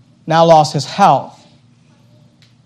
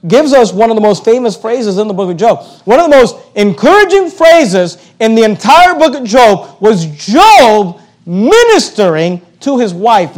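preaching swell; 3 semitones higher, 5 dB louder than usual. Acted.

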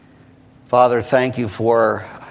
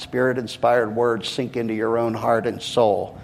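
ordinary talk

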